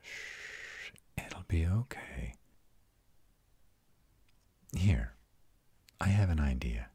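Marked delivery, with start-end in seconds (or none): 0.0-2.3 soft, breathy shush into gentle reassurance; soothing hush. Acted. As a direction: comfort someone upset, quietly. Comforting an upset companion.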